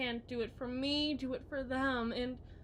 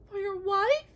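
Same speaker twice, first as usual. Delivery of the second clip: higher and much louder, flung at him; sharp accusing cry; 7 semitones higher, 12 dB louder than usual. Acted. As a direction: hurl it at him as the final blow. Wife accusing husband.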